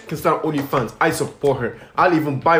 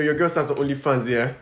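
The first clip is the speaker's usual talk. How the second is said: ordinary talk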